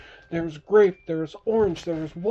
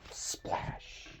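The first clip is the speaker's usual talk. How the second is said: whispered splash; 12 dB quieter than usual. Acted. Sound effect in bedtime story.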